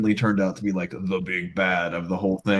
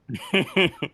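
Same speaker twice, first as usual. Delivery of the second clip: amused laugh; 11 semitones higher than usual. Real, not acted. Laugh after villain line.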